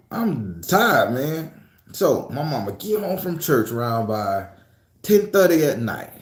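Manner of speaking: normal talking voice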